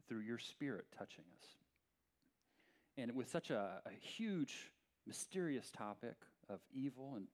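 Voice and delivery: low, unhurried praying voice; prayer. Real, not acted. Pastor asking God to guide his message, prayerful. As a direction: pray softly and earnestly, pitched low.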